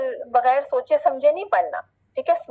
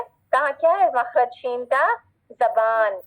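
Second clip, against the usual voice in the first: ordinary delivery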